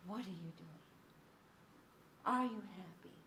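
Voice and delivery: put-on soft, slow baby-talk questions with a long gap. Shown as a demonstration. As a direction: ask a baby gently and unhurriedly. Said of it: "It has a slower tempo".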